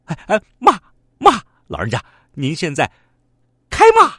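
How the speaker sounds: high, loud, urgent push to start scolding; eager pleading. Acted. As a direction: egg him on, eagerly. Thief begging old man to scold. (急切高声地催老人家开骂)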